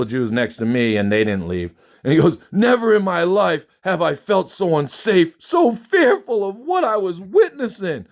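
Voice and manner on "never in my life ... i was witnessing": high, hammed-up fake fear; sarcastic high-pitched mock terror; mocking whiny voice. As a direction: parody the writer's melodrama. Mocking the post's author.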